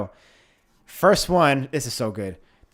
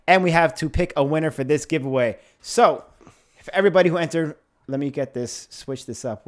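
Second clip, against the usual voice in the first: usual speaking voice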